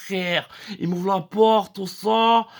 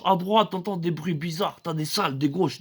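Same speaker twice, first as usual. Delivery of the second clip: ordinary talk, nothing marked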